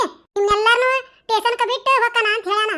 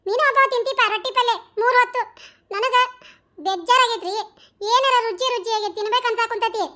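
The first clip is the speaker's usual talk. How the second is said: usual speaking voice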